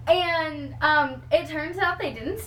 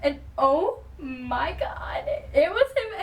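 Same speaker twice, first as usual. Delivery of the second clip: breathless awe; 3 semitones higher, slower than usual. Real, not acted. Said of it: "I wasn't even thinking".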